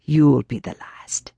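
slow, ominous whisper; whispered warning. A narrator's reading. Old woman warning Tommy, an ominous slow whisper. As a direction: whisper the doom slowly. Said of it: "she whispered".

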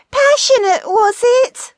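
very high, loud coy question; high-pitched comic wife; wife calling back. A narrator's reading. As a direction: call back in a shrill wifely falsetto. Wife calls back from other room.

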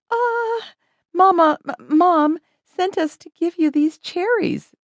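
high-voiced, halting young girl; flustered stammer. A narrator's reading. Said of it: "stammered Betty".

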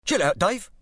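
light East London ease. A narrator's reading. Club boss calming gangster, East London voice.

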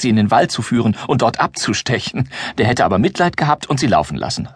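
ordinary delivery

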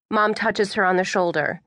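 ordinary delivery

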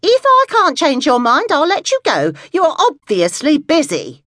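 put-on offended old lady, clipped goodbye; indignant. A narrator's reading. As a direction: hang up in a wounded huff. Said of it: "Alice was now indignant".

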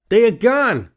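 high, loud holler. Acted. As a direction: shout it down the street. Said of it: "Yale hollered".

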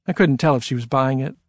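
plain reading voice

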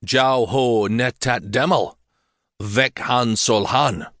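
slow, echoing chanted spell; echoing incantation. Acted. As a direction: intone it like a spell in a hall. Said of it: "the mage intoned in an echoing voice".

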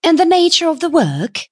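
high-pitched, pointed question; demanding young woman. A narrator's reading. Young woman questions a businessman about his job offer, demanding. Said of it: "she demanded".

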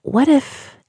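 slow, halting and high, unsure; hesitant. A narrator's reading. Diana nervously sounding out Kat, hesitant. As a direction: ease into it, afraid to ask. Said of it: "Diana said hesitantly".

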